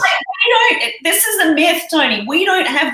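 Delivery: high, loud burst of protest; excited protest. Real, not acted. Guest protests to Tony that Aussies have no accent, in an excited burst. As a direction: jump in to object.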